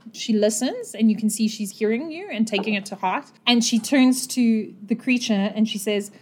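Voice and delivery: ordinary delivery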